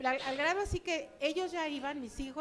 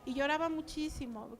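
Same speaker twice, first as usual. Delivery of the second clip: ordinary delivery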